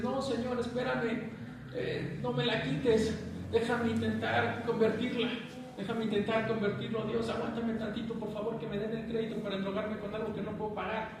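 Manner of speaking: high-pitched mock bargaining with God; pleading. Acted bargaining plea to God. (súplica fingida, con voz aguda)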